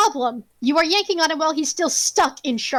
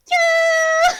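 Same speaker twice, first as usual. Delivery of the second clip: very high, loud cartoonish holler; goofy yell; 11 semitones higher, 4 dB louder than usual. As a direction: let out a silly drawn-out whooping cry. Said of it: "Just a fucking goofy yell of".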